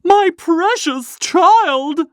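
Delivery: put-on a mother terrified for her child; hysterical scream. A narrator's reading. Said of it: "screamed the mother".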